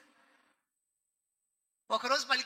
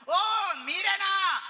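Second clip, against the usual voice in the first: loud, high, drawn-out scornful exclamation; mocking shout; 10 semitones higher, 9 dB louder, much slower than usual. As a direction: sneer it out loudly. Mocking Babylonian teacher.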